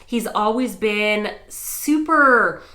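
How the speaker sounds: ordinary delivery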